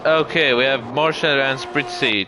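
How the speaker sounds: ordinary talk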